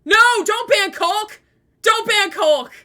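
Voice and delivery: real panicked no; panicked shout. Real, not acted. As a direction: yell to stop the mod. Mod about to ban a friend.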